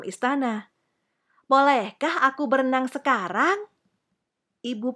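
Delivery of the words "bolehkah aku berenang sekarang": put-on high, hopeful child's question; eager little princess. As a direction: ask as a keen little girl. Princess asks to go swimming.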